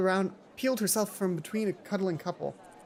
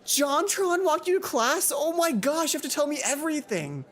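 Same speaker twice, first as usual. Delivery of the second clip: excited squeal; 9 semitones higher than usual. Acted. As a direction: shriek it like a starstruck fangirl. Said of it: "emitted a highly pressurized squeal".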